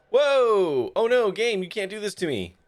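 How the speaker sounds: high, loud startled cry; shocked outcry. Real, not acted. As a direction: yelp in real dismay. Game restarts him unexpectedly.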